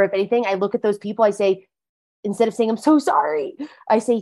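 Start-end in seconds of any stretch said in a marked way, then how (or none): 2.7-3.5 high-pitched mock apology; plaintive, higher-pitched sorry; over-apologetic. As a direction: imitate someone apologizing too much. Mimics the over-apology to avoid.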